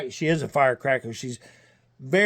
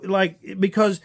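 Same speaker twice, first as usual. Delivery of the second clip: usual speaking voice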